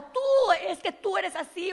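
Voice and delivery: high, loud accusing shout; imitating an angry yell. A preacher mimics an angry person accusing: loud mock yell. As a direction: mimic a person blaming someone at the top of the voice. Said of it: "Cuando una persona que grita".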